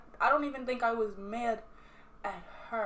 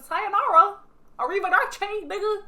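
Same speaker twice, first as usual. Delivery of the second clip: loud, high mock goodbye; sassy farewell; 9 semitones higher, 10 dB louder than usual. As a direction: send them off loudly, pitched way up.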